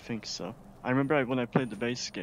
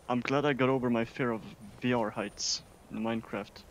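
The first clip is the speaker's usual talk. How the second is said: ordinary delivery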